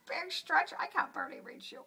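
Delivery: high cooing with a whispery tail; pet talk. Real, not acted. Sitter cooing at the stretching cat in high pet talk.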